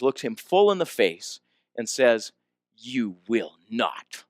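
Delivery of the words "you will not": low, very slow, measured refusal; cold defiance. Retold or imitated. Woman looking the man full in the face, slowly defiant. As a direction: stand your ground, each word slow and lowered.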